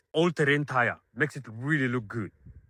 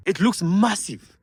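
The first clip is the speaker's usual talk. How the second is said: higher, louder, slower, stretching "looks"; impressed emphasis; 7 semitones higher, 4 dB louder than usual. Real, not acted. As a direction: lean into "looks" like you can't believe the size. Repeats for emphasis.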